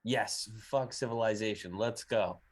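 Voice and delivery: normal talking voice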